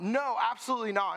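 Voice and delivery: high, panicked refusal; mock flipping out. Crowd refusing Caleb, high panicked. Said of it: "everybody else started flipping out".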